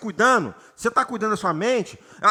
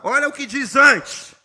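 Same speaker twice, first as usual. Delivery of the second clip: ordinary talk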